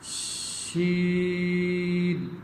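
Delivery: شین held long and slow, kept apart from سین; drawn-out sheen. Shown as a demonstration. Teacher separating sheen from seen for children. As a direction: stretch شین with a clear sh. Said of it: "سین شین پڑھتے وقت".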